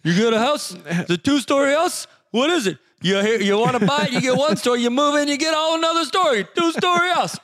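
high, excitable stand-up comic delivery; Rodney Dangerfield. Acted. Co-host doing a two-story house bit, comic impression. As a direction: rattle off the joke in a pushed-up comic voice. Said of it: "Rodney Dangerfield".

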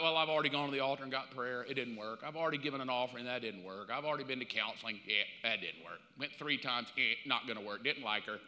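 low, fast, dismissive grumble; mock quitter. Preacher mimicking people who give up, low and dismissive. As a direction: rattle off excuses with a shrug.

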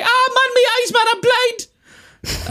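very high, wailing comic impression; hammed-up high-pitched regional wail; Ant and Dec. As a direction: cry out in mock agony. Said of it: "do that voice and pretend to be Ant and Dec".

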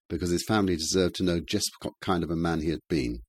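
plain reading voice

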